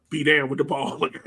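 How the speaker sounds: ordinary talk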